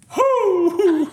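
high loud mock owl hoot. Dad on the dock calling his owl, loud hoot. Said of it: "and he's like".

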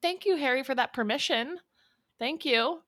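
high-pitched mock thanks; sarcastically grateful, pitched well up; sarcastic gratitude. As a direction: thank him with exaggerated, fake gratitude.

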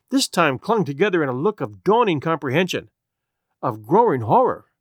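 plain reading voice

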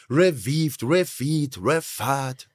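"Revived", "Refeed", "Refat" as three separate guesses; trying out pronunciations. Shown as a demonstration. Host shows guest how he mangles the name, guessing "Revived, Refeed, Refat". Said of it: "wie ich sage".

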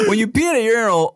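high, laughing burst. Real, not acted. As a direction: laugh while explaining. Laughing at the question.

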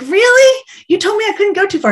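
high, loud, fast protest of disbelief; retelling an outraged reply to the director, high and quick; indignant surprise. Retold or imitated. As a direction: squeak out a wounded "really?". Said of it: "And I'm like".

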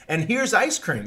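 high, bright-toned presenter reveal; mock TV host unveiling the result; cooking show reveal.

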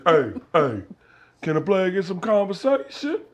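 a lightly voiced smooth street hustler; imitating pimp. Retelling a documentary pimp, a light smooth imitation. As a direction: slide into it like a smooth-talking pimp. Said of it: "He's like".

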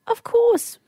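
high-pitched, tearfully eager to help; smiling through tears. Retold or imitated. Woman agreeing to help an elderly man, smiling through tears. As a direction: smile while close to crying. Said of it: "Like, smiling, tears streaming down my face".

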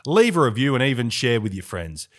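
bright sign-off ad read; podcast outro read. Acted. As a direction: pitch the listeners like an announcer.

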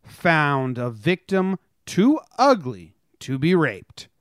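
slow, punchy newsreader delivery with dry jokes; news read. Acted. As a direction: read the headlines like a mock anchor, hitting each story. Reads news headlines.

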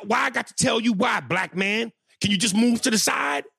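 a caterer snapping at a nosy stranger; angry shout. Retold or imitated. Caterer yelling at the comic to move, angry shout.

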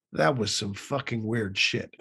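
slow, laughing Texan drawl; a slow, amused aside; lightly voiced Texan president, slowed; George W. Bush impression. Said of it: "Bush turned to her and said".

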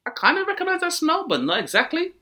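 high, prissy book club voice; high mock book club lady. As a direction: squeak it out like a dainty reader.

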